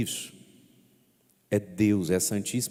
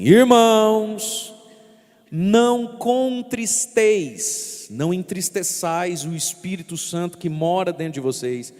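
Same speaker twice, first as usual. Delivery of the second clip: much higher and louder, very slow solemn exhortation; Saint Paul preaching; 8 semitones higher, 7 dB louder, slower than usual. Acted. Priest quoting Saint Paul's letter, loud and solemn. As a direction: proclaim it like an apostle to his flock. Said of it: "São Paulo ... vai dizer assim".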